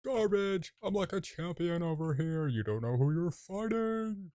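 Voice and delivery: high, loud champion's trash talk; mock boastful taunt. Player taunting phantoms as a champion, boastful yell. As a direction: crow it loudly at a beaten enemy.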